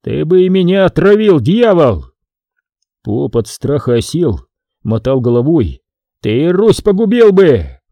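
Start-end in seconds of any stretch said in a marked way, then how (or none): 0.0-2.1 high, raised accusing cry; furious yell, dropping on the last word; angry shout. A narrator's reading. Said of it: "закричал". 6.2-7.8 slow, high accusing yell; outraged drawn-out shout; angry shout. A narrator's reading. Brenko's shouted accusation continues.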